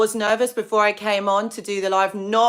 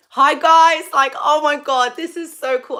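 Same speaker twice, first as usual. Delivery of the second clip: excited greeting; 7 semitones higher, 8 dB louder than usual. Real, not acted. Greeting viewers joining her live.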